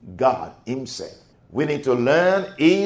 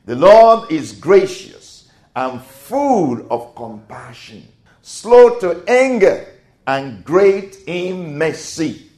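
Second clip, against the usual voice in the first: fervent; 5 semitones higher, 7 dB louder, slower than usual. Real, not acted. Reading scripture prayerfully.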